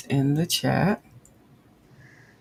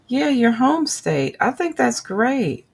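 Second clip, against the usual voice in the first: happy; 8 semitones higher than usual. Real, not acted. Still reacting to chat news.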